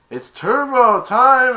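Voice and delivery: slow, high, loud battle cry; loud drawn-out hero call; hero catchphrase. Retold or imitated. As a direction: stretch out the superhero catchphrase. Acting hero's catchphrase.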